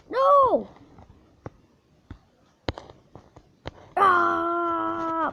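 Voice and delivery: loud high cry of dismay; anguished yell. Real, not acted. Player screaming as the jump fails. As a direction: scream it as you fall.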